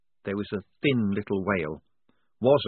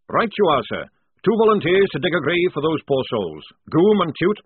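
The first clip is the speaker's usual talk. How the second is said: louder, higher barking sergeant; gruff sergeant; 8 semitones higher, 7 dB louder than usual. A narrator's reading. Jackram calling the squad to post sentries, loud. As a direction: order the lads about like a drill sergeant.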